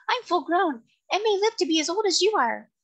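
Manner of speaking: high, excited little seahorse; indignant high-pitched creature voice; excited cry. A narrator's reading. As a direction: protest in a tiny excited voice. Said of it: "cried the seahorse excitedly".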